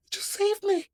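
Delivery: very high amazed relief. Retold or imitated. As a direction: squeak it in disbelief. Relief at police lie.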